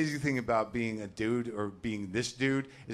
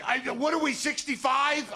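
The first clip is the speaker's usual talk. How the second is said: exploding at wife; 5 dB louder than usual. Retold or imitated. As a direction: blow up at her in a high mock yell. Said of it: "I said".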